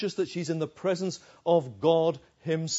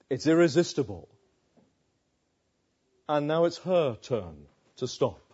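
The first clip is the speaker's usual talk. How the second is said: normal talking voice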